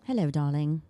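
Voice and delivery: posh British accent, a light put-on. Shown as a demonstration. British accent for a friend who asked to hear it. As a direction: say it like a British lady offering tea. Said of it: "Let me hear your British accent".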